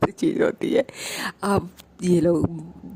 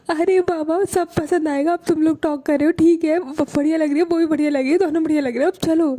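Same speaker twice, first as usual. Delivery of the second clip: higher and fast, rushing through the reassurance; hurried exasperation; 8 semitones higher, faster than usual. Retold or imitated. Bani hurries Chanchal along to reach Prachi, exasperated and fast. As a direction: brush her off quickly, eager to leave.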